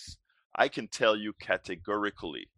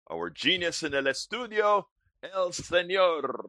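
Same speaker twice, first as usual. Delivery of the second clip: show announcer introduction; 9 semitones higher than usual. Acted. Host brings on his studio colleague, announcer build-up. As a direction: announce a star with ringing build-up.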